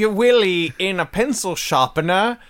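loud, high, hammed-up posh English accent. Mocking the English phrase, in a loud, hammed-up English accent. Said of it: "in England town".

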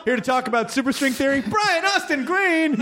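big showman announcement; announcer voice. Acted. Mock panel introduction.

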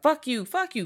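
high-pitched mock squabbling; mock arguing. Podcaster mimicking characters who keep fighting, high mock arguing. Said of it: "fighting and arguing".

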